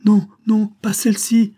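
very high, loud cry of refusal; alarmed cry. A narrator's reading. Sick woman rejecting the wrong Lady Liesel, alarmed cry. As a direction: cry out in alarm, pitched far up. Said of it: "s'écria-t-elle".

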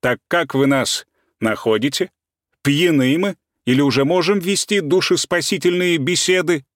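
pointed mocking; distinct, mocking. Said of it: "отчётливо повторил".

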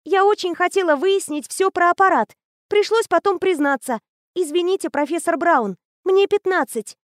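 put-on high, bright-eyed girl raving about a machine; eager teenage girl. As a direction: gush like a know-it-all teen. Anya voiced high.

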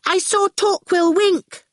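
put-on high, drawn-out excited little girl; gasped. Awful tells the goon, high gasping. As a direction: blurt it out to the rescuer. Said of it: "Awful gasped".

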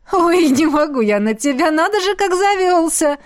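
can barely talk for laughing; laughing fit. Acted. Molly laughing at how worked up Jet got, breathless laughter. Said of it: "покатываясь со смеху".

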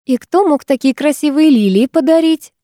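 put-on pleasantness; strained smile. A narrator's reading. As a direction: ask sweetly through a forced smile. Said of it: "с натянутой улыбкой".

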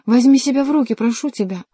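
loud, high start, easing at the end; urgent plea. A narrator's reading. Henri begs Schomberg to pull himself together, pleading loudly. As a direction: plead forcefully.

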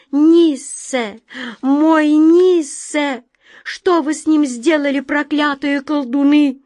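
put-on high, loud, terrified scream for her child; desperate cry. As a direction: cry out in horror. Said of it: "крик, полный отчаяния и ужаса".

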